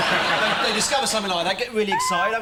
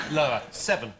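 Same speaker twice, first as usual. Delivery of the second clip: ordinary talk, nothing marked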